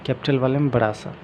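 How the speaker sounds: ordinary talk, nothing marked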